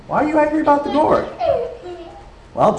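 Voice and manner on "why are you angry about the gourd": high, quick, with a laugh; a light laughing challenge to a sulking prophet; laughing mock question to Jonah. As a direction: tease Jonah, much higher and laughing. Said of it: "Ask him".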